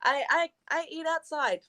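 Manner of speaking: lightly whiny retold protest; whiny refusal. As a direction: whine about eating outside. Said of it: "And I was like".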